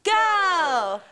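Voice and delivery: shouted cheer, leading the crowd; cheer call. Acted. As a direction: shout it to lead the room's cheer. Leading a call-and-response school cheer.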